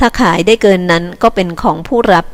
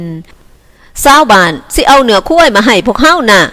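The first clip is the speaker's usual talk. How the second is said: slow, louder called-out line; shouting; 4 semitones higher, 5 dB louder, much slower than usual. Acted. Villager shouting across camp about buffalo meat. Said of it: "เสียงหนึ่งตะโกนไปว่า".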